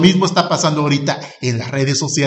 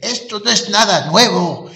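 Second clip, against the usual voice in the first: put-on lightly raised old man voice; a hint of a grumpy old man; 6 semitones higher than usual. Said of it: "voy a hacer la voz de viejito".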